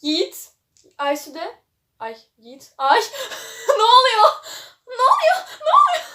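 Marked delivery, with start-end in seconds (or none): 3.8-6.1 rising higher and louder, laughing shriek; excited squeal. Real, not acted. Squealing at the romance twist. (gülerek yükselen heyecanlı çığlık)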